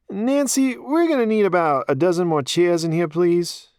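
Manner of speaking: put-on high, thick New York accent; the mayor in a New York accent. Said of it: "he asked in his thick New York accent".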